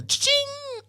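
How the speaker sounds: high, ringing "cha-ching"; cash-register cha-ching. Acted. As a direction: mimic a till ringing up money. Imitates a cash register sound.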